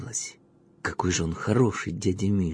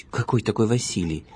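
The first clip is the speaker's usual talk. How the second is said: displeased. A narrator's reading. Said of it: "недовольным голосом спросила женщина".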